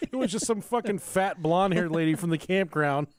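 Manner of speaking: can barely get it out for laughing; laughing while telling. Real, not acted. Man cracking up retelling his childhood mix-up, laughing.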